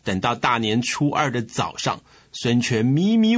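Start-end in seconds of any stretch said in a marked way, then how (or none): none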